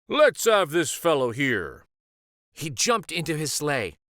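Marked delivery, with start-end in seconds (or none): 0.1-1.9 Santa voice. Acted.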